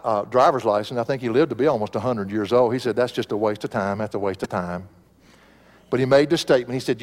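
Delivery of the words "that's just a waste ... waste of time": lightly imitated twangy old preacher, low; low, dismissive quote with a hint of twang; Vance Havner. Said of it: "had that little twang in his voice".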